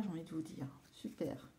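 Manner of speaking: whispers it under her breath; a quiet, breathy aside to herself; whispered aside. Real, not acted. (chuchoté en aparté)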